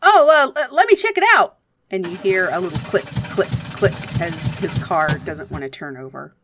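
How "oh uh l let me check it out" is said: high, loud and fast, flustered; a flustered local rushing his words; Rudy's voice. Acted. Game master voicing Rudy checking his dead car.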